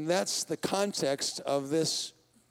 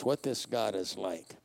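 ordinary talk

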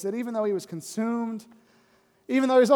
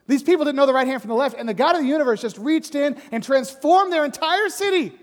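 fast, loud, higher pleading; passionate plea; 7 semitones higher, 7 dB louder than usual. Acted. God urging Jonah to see the saved city, passionate plea. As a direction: urge him with rising passion.

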